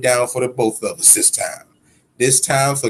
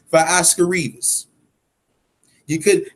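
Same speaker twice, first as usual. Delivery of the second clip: ordinary talk, nothing marked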